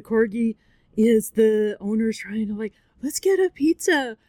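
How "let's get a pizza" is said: higher-pitched cheery coaxing; lightly voiced owner tempting the dog. Acts owner coaxing the dying dog.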